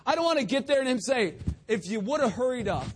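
loud, high-pitched pulpit exhortation; rally the congregation loudly; forceful preaching. Real, not acted.

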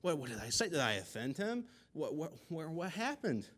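stammering, rising bewilderment; dumbfounded grandma. Acted. Grandma left alone on the porch, dumbfounded. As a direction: act out baffled questions, pitch climbing. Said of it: "she was dumbfounded".

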